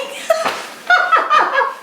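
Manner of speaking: high shrieking laugh; laughing hard. Real, not acted. Cracking up at Gage's reply, shrieking with laughter. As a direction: crack up helplessly.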